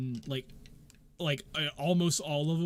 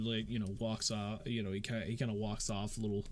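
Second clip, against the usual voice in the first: usual speaking voice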